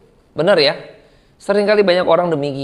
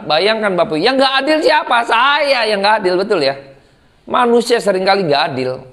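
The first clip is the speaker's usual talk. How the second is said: ordinary delivery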